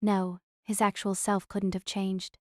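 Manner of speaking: ordinary delivery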